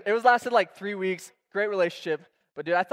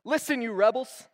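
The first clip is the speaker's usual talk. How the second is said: higher, louder rebuke; mock booming Moses; 6 semitones higher, 6 dB louder than usual. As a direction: bellow it like an angry prophet. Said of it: "He says".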